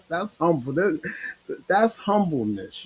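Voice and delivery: usual speaking voice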